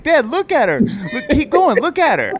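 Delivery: high, loud, excited imitation of children; amazed kids. Kids can't stop telling dad to look at mom, imitated amazement. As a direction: urge Dad on in gleeful astonishment. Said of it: "the kids were all so amazed".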